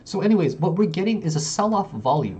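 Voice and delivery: ordinary talk, nothing marked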